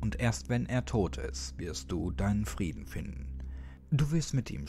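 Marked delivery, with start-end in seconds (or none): none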